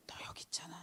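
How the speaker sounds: whispered, soft divine assurance; God's whispered presence; hushed voice of God. Acted. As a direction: breathe it like God speaking close. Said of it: "하나님의 음성으로 들으세요".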